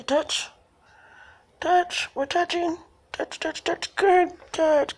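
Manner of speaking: cheerful high praise for a cat doing a trick; pet talk. Real, not acted. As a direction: lift the voice to encourage the cat. Coaxing cat to touch.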